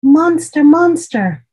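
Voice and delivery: high, loud call into the dark room. Acted. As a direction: call out for the monster. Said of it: "Zuri called out".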